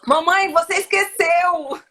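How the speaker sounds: put-on loud, slow, high child's complaint; child whining. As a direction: whine like a kid catching mom out. Child voiced in retold story.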